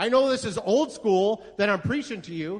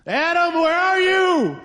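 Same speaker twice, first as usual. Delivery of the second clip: loud, high, drawn-out shout; calling out; 8 semitones higher, 6 dB louder, slower than usual. Acted. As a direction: call out for someone lost, high and long. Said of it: "God called out to him".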